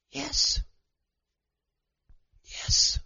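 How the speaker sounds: hushed but emphatic, with weight; emphatic yes. Real, not acted. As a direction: drop the volume and let each yes land alone. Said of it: "the answer emphatically is yes".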